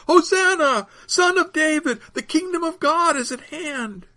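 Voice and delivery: high, ringing crowd cry; calling out. A narrator's reading. Crowd proclaiming the kingdom of God, high shout. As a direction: proclaim it high over the throng. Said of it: "another would call".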